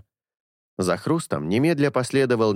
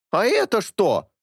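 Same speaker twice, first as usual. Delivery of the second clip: возмущённая женщина; 11 semitones higher than usual. A narrator's reading. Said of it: "воспротивилась одна из них".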